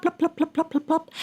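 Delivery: high, soft plops; plop sounds. Acted. Narrator voicing rice cakes falling, high soft plops. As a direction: drop each plop like a rice cake.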